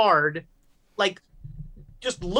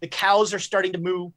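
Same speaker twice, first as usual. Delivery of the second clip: usual speaking voice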